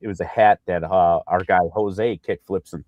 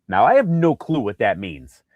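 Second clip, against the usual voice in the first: ordinary talk, nothing marked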